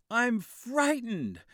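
high, slow, loud admission of fear; frightened boy. A narrator's reading. Boy telling his father he is scared, high and slow. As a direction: let the fear spill out.

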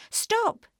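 high, loud cry to halt; urgent shout. A narrator's reading. Dorothy calls to rising balloon.

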